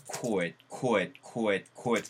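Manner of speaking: could said as "koet", rounded with a t ending; koet sound. Shown as a demonstration. Teacher drilling could as koet, repeated softly. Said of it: "could가 아니고 뭐?".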